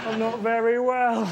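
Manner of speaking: hammed-up sick voice; mock feeble patient. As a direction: say it slowly like a pitiful patient. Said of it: "I didn't just".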